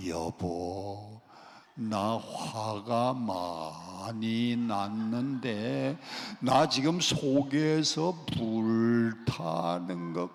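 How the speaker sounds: very slow, soft, low, calm telling; slow anger. Pretend. Pastor shows how to tell wife of anger, slow and soft. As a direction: say your anger slowly and gently. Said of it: "천천히 화내세요".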